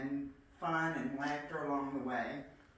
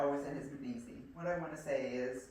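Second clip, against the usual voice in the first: normal talking voice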